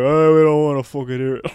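gruffly slurred Irish brush-off; mock Irish accent. Imitating Irish audience members refusing explanation, slurred mock Irish accent. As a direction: imitate drunk Irishmen running words together. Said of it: "They're like".

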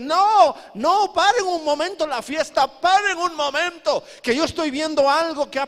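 high, loud pleading shout; Satanás in alarm. Acted. Devil telling demons to stop the feast, alarmed shouting. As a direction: urgently halt the celebration. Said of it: "Satanás dice".

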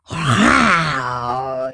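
loud, low growling roar; bear roar. Acted. The grumpy bear bursts out, a loud low roar. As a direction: let out a big bear roar. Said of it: "hizo el oso".

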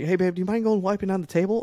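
high, quick, sing-song request; imitating wife. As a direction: chirp the request brightly and fast. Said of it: "it'll be like".

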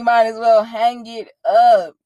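loud, teasing jab at a losing gamer; mock trash talk. Girlfriend mocking her boyfriend's gaming, teasing trash talk. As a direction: rib him hard about losing the game. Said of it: "I gotta talk the good shit".

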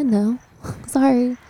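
ordinary delivery

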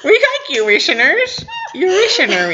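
loud high giggling words; laughing. Real, not acted. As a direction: laugh through the silly word. Joking over a flubbed word.

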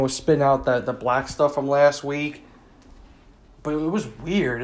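usual speaking voice

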